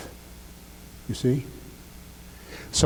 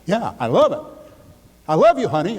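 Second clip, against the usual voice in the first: higher and louder, gushing to honey; enthusiastic love; 5 semitones higher, 4 dB louder than usual. Shown as a demonstration. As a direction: pour real feeling into it. Shows loving it with emotion.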